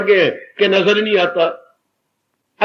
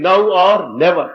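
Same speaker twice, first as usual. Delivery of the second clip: slow quoted motto; 3 semitones lower, much slower than usual. Retold or imitated. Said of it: "Swami जी यही तो कहा करते थे".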